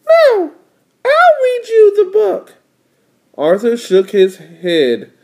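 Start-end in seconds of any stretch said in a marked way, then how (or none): 0.1-2.5 put-on very high, insistent child voice; little sister. Little sister overruling her brother's offer, very high voice. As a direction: insist in a squeaky cartoon kid voice.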